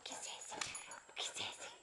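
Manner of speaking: urgent whispered coaxing. Real, not acted. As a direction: whisper it so the dog won't hear. Mother urges baby quietly.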